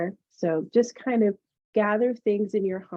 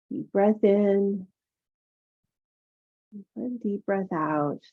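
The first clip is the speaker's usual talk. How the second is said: soothing massage murmur. Acted. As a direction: ease them into calm breathing. Yoga teacher guides breathing meditation.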